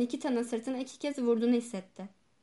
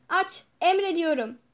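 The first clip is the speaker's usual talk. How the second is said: higher, louder insistent order; shouted command; 5 semitones higher, 6 dB louder than usual. A narrator's reading. Doctor demands the locked door be opened, shouted command. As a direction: shout it with authority. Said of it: "diye bağırdı".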